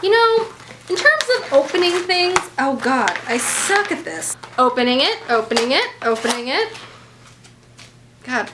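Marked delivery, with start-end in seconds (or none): none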